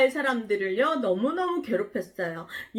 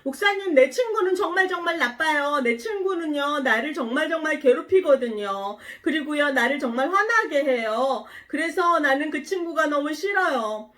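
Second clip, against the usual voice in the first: put-on higher-pitched voice of an upset child; complaining child; 4 semitones higher, 3 dB louder than usual. Child complaining to the pastor about a mean friend, whiny complaint. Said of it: "이렇게 질문을 하는 거예요".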